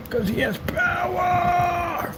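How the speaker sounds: high, very drawn-out hammed-up cry; mock-epic cry. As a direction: stretch POWER like a cartoon hero. Mock-epic punchline about power.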